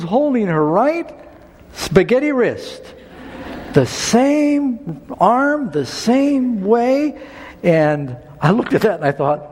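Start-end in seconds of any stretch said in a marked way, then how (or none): 3.7-7.2 high, very slow, stretching each "same"; slow emphatic stress. Real, not acted. Pastor marvelling at the matching broken arm, slow emphatic. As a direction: lean hard on "same", drawn out high.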